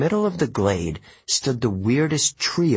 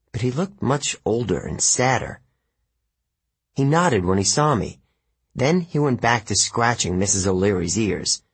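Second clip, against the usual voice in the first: ordinary delivery, nothing marked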